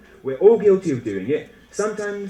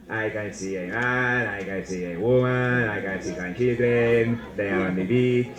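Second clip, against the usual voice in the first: put-on Spanish accent; 5 semitones lower than usual. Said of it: "That's my Spanish accent".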